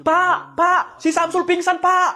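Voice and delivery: high, loud alarmed cry for the teacher; a student yells to the teacher that Samsul fainted; panicked call. Acted.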